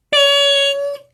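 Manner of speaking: playful spring noise; boing sound. Acted. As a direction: make the tail go ping. Tail springs back.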